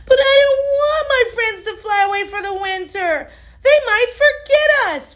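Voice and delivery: put-on high, pleading young hippo voice; a small girl afraid her friends will forget; little Fiona, worried.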